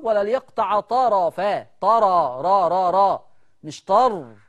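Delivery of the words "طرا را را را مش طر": "را" stressed with an open a, against "طر"; drilling the ra. Shown as a demonstration. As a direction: repeat "را" clearly, then reject "طر". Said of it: "الراء مش ساكنة".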